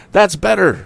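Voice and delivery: high call over the noise; shouted. Acted. Tom shouting encouragement to the woman, high call. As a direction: call it out while riding. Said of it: "shouted Tom".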